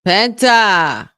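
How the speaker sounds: hyped entrance call; loud call-out. Real, not acted. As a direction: shout the name in excitement. Calling wrestler's entrance.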